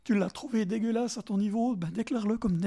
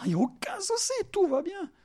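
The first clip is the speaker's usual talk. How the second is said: high-pitched mock "all is fine"; feigned reassurance; 7 semitones higher than usual. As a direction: brush it off in a put-on high voice.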